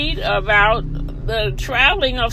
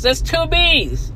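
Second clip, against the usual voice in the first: normal talking voice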